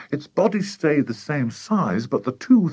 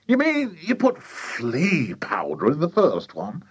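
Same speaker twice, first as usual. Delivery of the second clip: slow, high, drawn-out question; incredulous father; 5 semitones higher than usual. A narrator's reading. Father hears about the flea powder, incredulous drawl. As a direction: stretch it out in disbelief. Father astonished at boy's confession.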